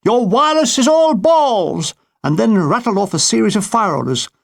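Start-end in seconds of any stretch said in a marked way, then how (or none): 0.1-1.9 high, loud, slow shout from a plane; pilot's shout. Retold or imitated. Infuriated pilot shouting down at the command post, loud and high. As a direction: bellow it down from the cockpit. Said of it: "shouted to the window".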